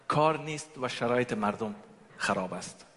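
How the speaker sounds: ordinary talk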